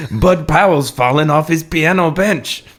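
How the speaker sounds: put-on hammy Cajun French accent. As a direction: play a nervous Cajun Frenchman. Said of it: "my incredibly accurate French accent".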